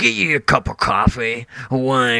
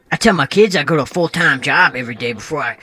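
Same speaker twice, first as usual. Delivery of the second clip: ordinary talk, nothing marked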